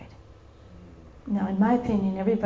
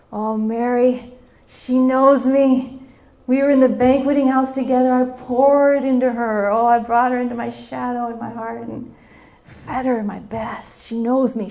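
fondly impassioned; 2 semitones higher, 9 dB louder than usual. Acted. Voicing Jesus's fond thoughts of Mary.

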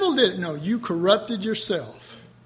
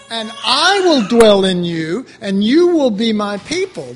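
emphatic; 9 dB louder than usual. Shown as a demonstration. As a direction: drive the promise home. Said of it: "do you see the emphasis there".

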